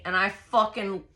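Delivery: usual speaking voice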